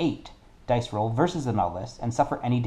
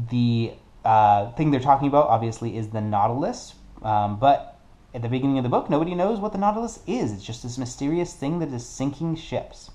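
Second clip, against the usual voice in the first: ordinary talk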